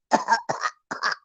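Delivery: dry acted cough; mimicked harsh cough; coughing fit. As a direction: hack it out.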